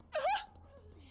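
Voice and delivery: high cartoon giggle; a squeaky Mickey chuckle; Mickey Mouse laugh. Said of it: "I used to could do Mickey Mouse".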